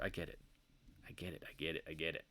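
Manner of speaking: quiet weary dismissal; dismissive. Acted. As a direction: brush it off under the breath. Dismissive repetition.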